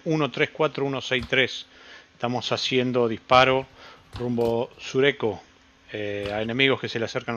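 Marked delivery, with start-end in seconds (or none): none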